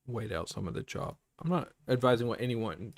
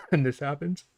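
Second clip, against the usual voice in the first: coughing, strained, much higher and louder; choking on spit; 8 semitones higher, 11 dB louder than usual. Real, not acted. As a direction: speak while choking on spit. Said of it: "I'm choking on my spit".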